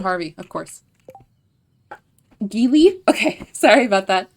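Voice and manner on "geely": "Geely" with a hard G, asked louder and rising; new pronunciation. Real, not acted. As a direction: try "Geely" as "ghee-lee", questioning. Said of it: "I shall pronounce it Geely".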